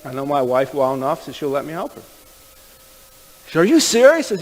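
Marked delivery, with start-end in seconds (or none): 3.6-4.3 high, loud imitated amazement; astonished disbelief. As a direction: squeal the question in disbelief. Woman's disbelief at his offer.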